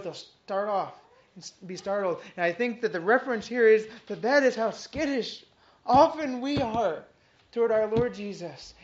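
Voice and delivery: ordinary talk, nothing marked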